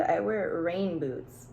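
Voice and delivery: usual speaking voice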